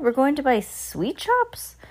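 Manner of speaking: high, rising, incredulous question; baffled crowd crying out; astonished cry. A narrator's reading. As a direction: ask high in disbelief. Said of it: "they all cried".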